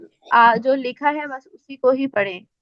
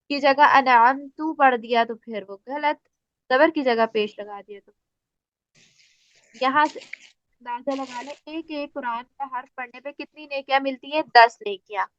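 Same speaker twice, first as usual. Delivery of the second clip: ordinary delivery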